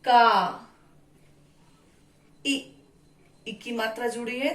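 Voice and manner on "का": का loud, with a long held "aa"; का said alone, firmly, vowel stretched; syllable pronunciation demo. Shown as a demonstration. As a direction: sound out का as a drawn-out "kaa". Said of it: "तो इसका उच्चारण हो गया".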